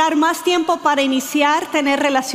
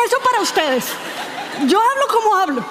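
higher, gruff macho bluster, hammed up; mock macho husband; 7 semitones higher, faster than usual. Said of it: "Y Danilo dijo".

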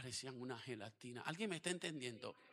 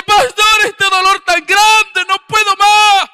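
high, very loud, slow cry of grief; mother crying out her pain to the pastor; anguished cry; 34 dB louder, much slower than usual. Retold or imitated. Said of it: "Me dice".

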